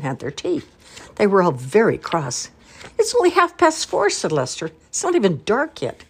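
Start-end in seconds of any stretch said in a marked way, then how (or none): 3.0-6.0 put-on child's protest. A narrator's reading. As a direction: whine it like a cross small boy. Cross child protests bedtime.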